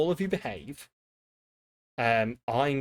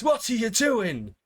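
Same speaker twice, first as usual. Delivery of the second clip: mock outraged cry; 8 semitones higher, 3 dB louder than usual. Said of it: "he goes".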